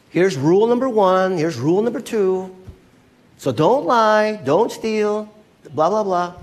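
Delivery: preachy rule-giver, hammed up; mock rule-teaching. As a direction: reel off rules in a mocking sing-out. Mocking a rule-based religion.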